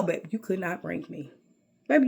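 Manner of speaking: normal talking voice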